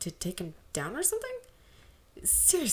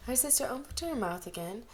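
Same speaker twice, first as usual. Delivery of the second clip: plain reading voice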